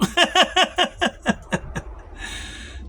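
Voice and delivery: long, high-pitched cackle; big laugh. Real, not acted. Laughs loudly at his sign-off.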